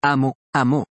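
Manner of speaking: "Amo" stressed on the first syllable, then "amó" stressed on the last; stress contrast demo. Shown as a demonstration. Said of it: "se distinguen significativamente por el lugar donde recae el acento".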